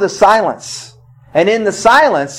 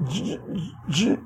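stuck on a word; 15 dB quieter than usual. Retold or imitated. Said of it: "she could not say Jesus".

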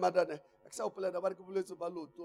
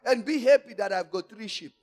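preaching at full force; 7 semitones higher, 10 dB louder than usual. Acted. Preacher raising voice.

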